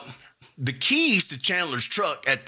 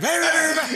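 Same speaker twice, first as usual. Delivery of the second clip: high, loud mock yelling rah rah; imitating the brother screaming in his face; 13 semitones higher, 3 dB louder, faster than usual. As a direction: bark out a silly high rah-rah rant. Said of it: "you don't have to scream at me".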